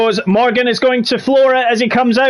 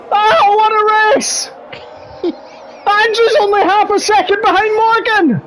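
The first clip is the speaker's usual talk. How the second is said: excited race call; 13 semitones higher than usual. Acted. Commentator calling the final lap to viewers, high and excited. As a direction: rise to a frantic finish.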